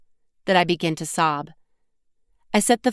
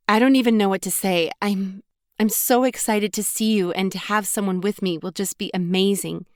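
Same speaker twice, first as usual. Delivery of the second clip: ordinary delivery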